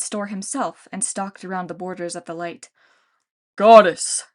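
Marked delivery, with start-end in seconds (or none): 3.6-4.2 loud giant shout. A narrator's reading. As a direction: call her out of the store. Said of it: "he shouted".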